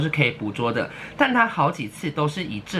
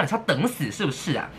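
normal talking voice

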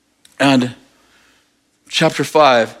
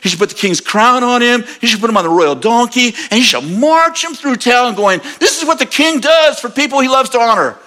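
eager Haman; 8 semitones higher than usual. Retold or imitated. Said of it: "He goes".

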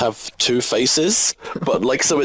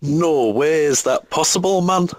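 a mock Scandinavian accent, pitched up, sing-song; failed Norwegian accent; 6 semitones higher than usual. Guest tries the quiz catchphrase in a failed Norwegian accent. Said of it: "I don't know what accent that was".

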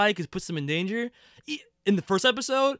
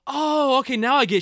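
mock understanding; 5 semitones higher, 5 dB louder than usual. Co-host retelling a show character's reaction, mock understanding. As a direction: imitate someone suddenly getting it. Mimics a woman unexpectedly appeased.

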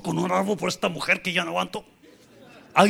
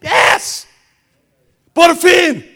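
high, loud celebratory yell; triumphant relief; 11 semitones higher, 10 dB louder, much slower than usual. Acted. Leader hearing the plane is finally ready, triumphant shout. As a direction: shout it out as a long-awaited victory.

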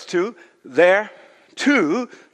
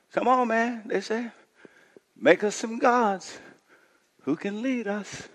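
lowered, conspiratorial; hushed urging; 3 semitones higher, 8 dB quieter than usual. Acted. People pressing Aaron for gods, hushed and coaxing. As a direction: lean in and coax Aaron.

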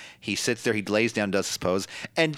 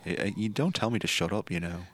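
softer mock Liverpool accent; low-key Liverpudlian imitation, trailing softer; 3 semitones lower, 7 dB quieter than usual. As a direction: object mildly in put-on Scouse.

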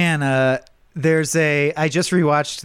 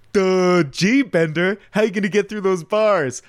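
higher, dopey mock-Bender; dumb Bender voice; 6 semitones higher than usual. Bender talking to himself at the grate, playing dumb. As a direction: play Bender as a dimwit. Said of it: "Bender as Santa trying to figure".

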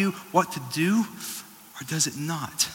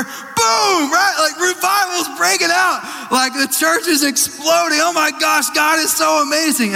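much higher, gushing delight; excited; 12 semitones higher, 3 dB louder than usual. Pretend. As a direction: hype it up like revival. Mock excited revival hype.

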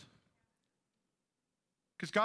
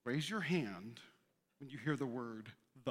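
lower, soft clinical doctor voice; hushed, lower hearing-test instructions; doctor's voice; 7 semitones lower, 7 dB quieter than usual. Retold or imitated. Audiologist talking to the pastor in the booth, low and soft. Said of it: "the doctor would go".

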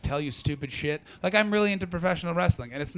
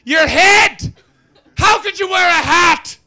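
put-on high, loud drunken bellow, laughing through it; mock drunk hollering; drunk shout; 12 dB louder, slower than usual. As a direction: yell it like a drunk mocking a stranger. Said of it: "And he went".

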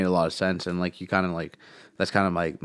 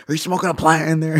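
much higher, louder mocking cop voice; 9 semitones higher, 7 dB louder than usual. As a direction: ham up a suspicious cop. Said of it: "they'll be like".

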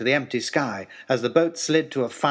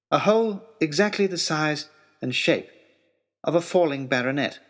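usual speaking voice

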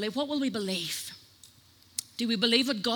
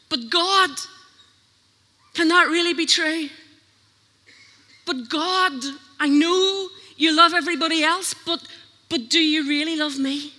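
louder, higher doubting plea; pleading but God; 3 semitones higher, 5 dB louder than usual. Retold or imitated. Believer objecting to God, higher whiny pleading. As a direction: whine your excuses up to God. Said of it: "have you ever said "but" to God".

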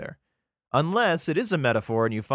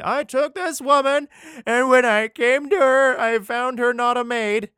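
higher, louder, slower complaint; voiced accusing husband; 11 semitones higher, 7 dB louder than usual. Husband accusing his bride before the elders, indignant and slow.

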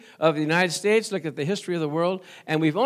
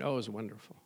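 offhand praise; 9 semitones lower, 14 dB quieter, much faster than usual. Retold or imitated. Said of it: "we use that term loosely".

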